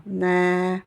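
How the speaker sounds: NE with the E held two beats; prolonged vowel. Shown as a demonstration. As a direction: stretch the vowel of "Ne" steadily. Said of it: "je prononce le son E en deux temps".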